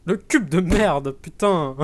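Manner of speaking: slow, loud frustrated curse; frustrated cursing. Real, not acted. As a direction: drag out the curse angrily.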